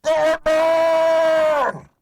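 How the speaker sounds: bellowed name. Acted. Croc bellows at Batman, furious roar. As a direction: bellow his enemy's name with rage.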